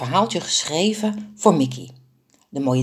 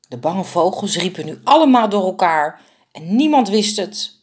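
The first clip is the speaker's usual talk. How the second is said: ordinary delivery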